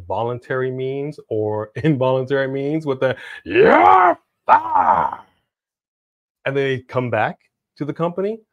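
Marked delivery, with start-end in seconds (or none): 3.4-5.2 loud, high, hammed-up dismissal; mock angry shout, drawn out; boss firing someone. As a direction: bellow it like a showman sacking someone.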